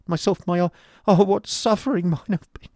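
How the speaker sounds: high, loud, broken outcry; falter, then cry out in misery; high-pitched wail of suffering; anguished lament. A narrator's reading. Boy cries out at his suffering.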